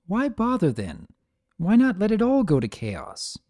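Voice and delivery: put-on huffy, high young mare; exasperated filly. A narrator's reading. Filly snorting at her mother in the garden, exasperated. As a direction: toss it off in frustration. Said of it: "snorted and stomped her hoof".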